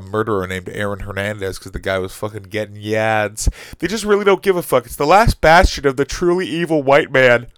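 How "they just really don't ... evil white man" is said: loud angry rant. Real, not acted. Ranting about NFL.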